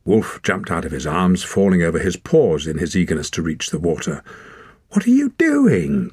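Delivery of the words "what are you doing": high, slow alarmed cry. A narrator's reading. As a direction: call out in alarm. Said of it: "cried Wren".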